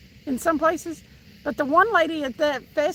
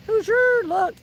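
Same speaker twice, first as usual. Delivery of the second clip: name called out higher and louder; excited call; 8 semitones higher, 3 dB louder, slower than usual. Real, not acted. Calling viewer Hoosier to look at the screen, excited. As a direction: grab his attention, excited.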